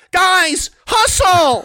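high shrill sideline yell; screaming soccer mom. Retold or imitated. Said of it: "the moms that scream".

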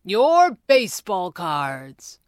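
slow, loud, proud announcement. A narrator's reading. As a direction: show them off, stretching the words. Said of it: "said proudly".

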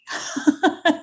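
short high laugh; bright burst of laughter; quick laugh. Real, not acted. Laughs after her catchphrase.

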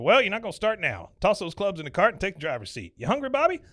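high, loud hammed-up boss; bossy Buck. Buck sending Bobby to the golf cart, loud bossy voice. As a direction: order him about with bluster.

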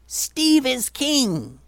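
high, drawn-out cheer; slow, high-pitched triumphant yell; yelling proclamation. Acted. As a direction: proclaim it to a crowd, stretching each word. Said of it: "he yelled".

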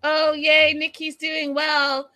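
high, loud happy cheer; light up over good news; delighted. Real, not acted. Reading viewer comment, delighted.

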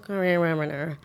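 imitating a garbled, slurred order; mock-mumble the word into mush; unintelligible mumbling. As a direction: mimic someone barely getting the word out. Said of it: "I don't really understand what they're saying".

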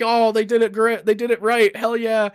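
high, bright-eyed cheer; excited cheering. Retold or imitated. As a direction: cheer the movie on like a fan. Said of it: "I'm like".

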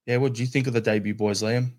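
ordinary talk, nothing marked

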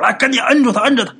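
high, loud call for help; rally everyone to pin him down; urgent order. A narrator's reading. Uncle orders everyone to restrain him.

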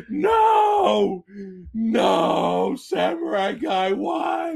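high, shouted mock lament; hammed-up Obi-Wan anguish; mock anguished cry. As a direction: wail it like a betrayed fan. Mock Star Wars lament.

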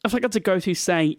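ordinary talk

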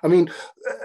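ordinary talk, nothing marked

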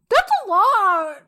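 high, loud and slow through real laughter; laughing hard. Real, not acted. Laughing so hard at the Oreo question she is crying. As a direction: squeal it out while cracking up. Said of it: "I'm literally crying".

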